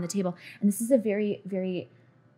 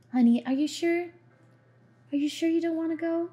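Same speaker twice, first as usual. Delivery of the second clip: a light, higher motherly coax; gentle mother voice; 9 semitones higher than usual. Acted. Mother gently asking her little girl.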